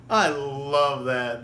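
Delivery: ordinary talk, nothing marked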